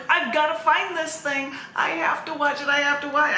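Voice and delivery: excited. Retold or imitated. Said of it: "and I'm like".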